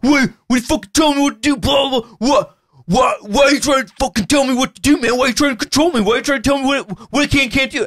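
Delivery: hammed-up hungover roommate taking offence; angry roommate. Hungover roommate snaps at teller over pot, hammed-up high indignation. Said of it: "He's like".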